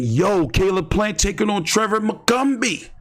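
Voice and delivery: hyped-up fan call, pitched high; mock hype. Boxing podcaster mocking fan hype for a dull fight, high and excited. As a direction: sell the matchup like a true fan. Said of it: "nobody saying".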